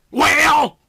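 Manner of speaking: sharp loud high bark. A narrator's reading. Detective barking at the hesitant sergeant. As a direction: bark the single word out impatiently. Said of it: "Andrew barked".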